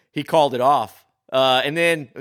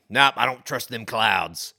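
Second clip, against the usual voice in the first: drawling captain; 2 semitones lower than usual. Retold or imitated. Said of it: "the way they said it".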